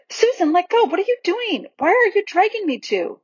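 put-on high, indignant child's protest; flustered, high-voiced complaint; alarmed protest. A narrator's reading. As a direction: object in alarm, pitched well up. Peter protests at being dragged.

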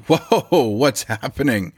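walking in on a fight, startled; startled whoa. Retold or imitated. Boss walking downstairs into a shouting match, startled. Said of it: "I'm like".

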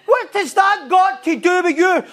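shouted rebuke. Retold or imitated. Preacher shouting Jesus's rebuke to the congregation. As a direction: hammer the question home.

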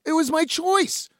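high-pitched, mildly indignant comeback; retold self-justifying retort; defensive protest. Retold defence of van life.

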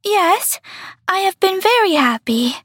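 put-on high childlike star voice; small wistful voice; little star voice. A narrator's reading. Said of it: "said the star".

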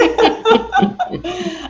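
high laugh tailing off; high giggle. Real, not acted. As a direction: laugh at your own confession. Host laughs at confession.

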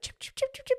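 high, quick bird chirps; chirping imitation. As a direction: imitate a baby bird's repeated high chirp. Imitating the chirping heard at home.

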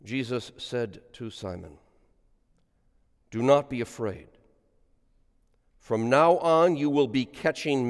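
solemn liturgical reading aloud; gospel reading. Acted. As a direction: intone the gospel for the congregation.

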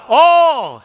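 loud, high single word; emphatic answer. Acted. Preacher answering how much unrighteousness, loud and high. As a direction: hit it hard and high. Answering his own question.

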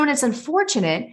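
ordinary talk, nothing marked

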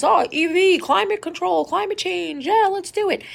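high, hammed-up mock campaign voice; mocking politician. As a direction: parrot the slogans like empty cheerleading. Mocking the president's slogans.

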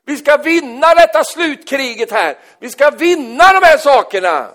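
loud, high preaching voice. Acted. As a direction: predikant i full kraft, hög och stark röst. Preacher at full force. (predikar högt och kraftfullt)

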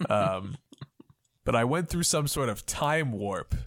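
low, measured confession with pauses; earnest time traveller, low and deliberate; solemn future self. Acted. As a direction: reveal a grave secret calmly. Game master voices the conductor's confession.

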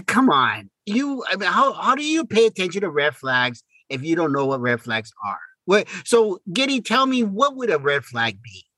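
ordinary talk, nothing marked

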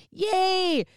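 high, loud cheer; kid yay. Retold or imitated. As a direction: cheer like an excited trick-or-treater. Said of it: "it can be like".

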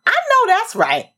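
high-pitched, hammed-up cheer of agreement; imitated yell. Viewers high-fiving over a TV clip, mock yelling out. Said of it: "and we yell out".

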